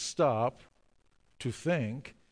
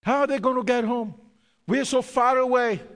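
fast, higher, louder worried thought; mock worry; 9 semitones higher, 6 dB louder, faster than usual. Pastor voices his worried thought about stranded men, fast and higher. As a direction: rush the anxious question. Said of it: "I'm thinking".

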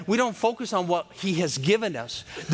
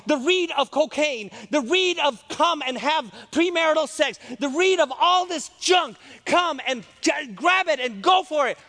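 loud, high preacher's cry, hitting each repeated phrase; full-force preaching; 9 semitones higher, 3 dB louder than usual. Real, not acted. Preacher warns congregation off worldly greed, full-force preaching. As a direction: preach it at a shout, punching the key words.

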